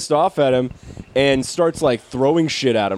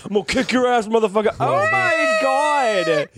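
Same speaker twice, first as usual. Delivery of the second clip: mock tough guy turned whiny; 10 semitones higher than usual. Mocking the sprayed tough guy.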